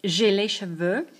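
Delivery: ordinary talk, nothing marked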